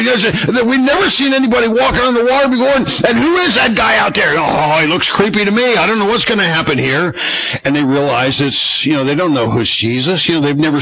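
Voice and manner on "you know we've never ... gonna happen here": high, fearful babble easing down; frightened disciples. Retold or imitated. Disciples puzzling over the figure, high scared voice calming. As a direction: start panicked and let it settle. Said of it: "You know, they're terrified".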